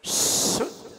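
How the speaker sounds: शुद्ध with a loud, long hissing श; whistled श. Shown as a demonstration. Teacher shows how it is said, forceful drawn-out sh in शुद्ध. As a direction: explode into शुद्ध on a strong whistling sh. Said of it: "शुद्ध कैसे बोला जाता है?".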